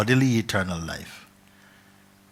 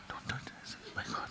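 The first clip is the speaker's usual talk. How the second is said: softer, hushed and awed; frightened whisper; 13 dB quieter, faster than usual. Acted. Seeker in the cave whispering, afraid. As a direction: whisper it in fear.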